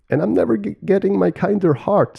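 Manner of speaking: high-pitched turkey character voice; whining turkey. Acted. Turkey grumbling to his peacock brother, high-pitched complaint. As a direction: complain in a high cartoon bird voice.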